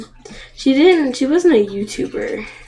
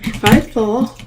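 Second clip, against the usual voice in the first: normal talking voice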